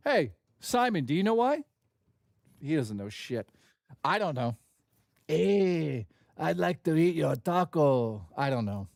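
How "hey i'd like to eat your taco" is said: slow, drawn-out mock Mexican accent; hammed-up Mexican accent. Streamer jokes about eating tacos, put-on Mexican accent. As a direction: stretch it into a stoner-style Mexican caricature. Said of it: "Now I'm just Mexican".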